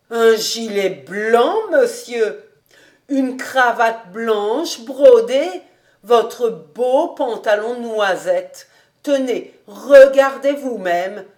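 slow, weighted listing of each garment; emphatic old housekeeper. Acted. Housekeeper choosing the master's outfit, slow and emphatic. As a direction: present each piece slowly and proudly.

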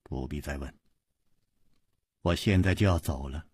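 very low, hushed, icy dismissal; quiet, low, detached coldness; cold. A narrator's reading. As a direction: cut off the questions coldly and softly. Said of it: "黑衣人冷冷地说".

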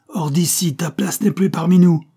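the marquis's fury boils over into a full shout; furious outcry, climbing. A narrator's reading. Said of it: "s'écria le marquis de Kerdal, ivre de désespoir et de fureur".

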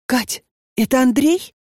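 worried question. A narrator's reading. Lenochka anxiously asks.

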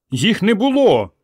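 passengers crying out, high and slow; shouted. A narrator's reading. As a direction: call out in alarm, slowly. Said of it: "закричали пасажири".

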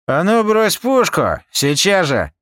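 high, loud and slow order; angry command. Acted. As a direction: demand the gun dropped now. Pomidor holds Rika at gunpoint.

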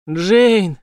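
loud, menacing call of her name; terrifying shout. A narrator's reading. Villain in the choir loft shouting at Jane, terrifying call. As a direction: boom her name from the dark. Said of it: "Страшный голос".

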